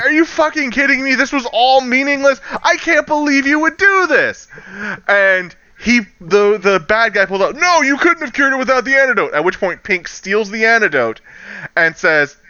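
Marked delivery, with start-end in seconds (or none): 0.0-4.5 high-pitched outraged retelling; pissed off. Retold or imitated. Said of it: "Birdie and I got pissed". 7.6-9.3 fast, high mock outburst; villain's protest.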